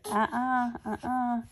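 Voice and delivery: normal talking voice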